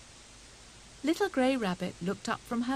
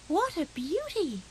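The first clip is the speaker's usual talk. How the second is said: higher, delighted exclaim; admiring exclamation; 8 semitones higher than usual. A narrator's reading. Grey Rabbit admiring the snowball, delighted exclaim. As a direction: gasp in admiration. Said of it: "exclaimed Grey Rabbit".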